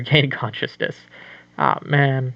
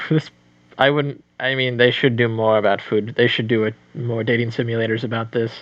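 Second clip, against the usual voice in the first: normal talking voice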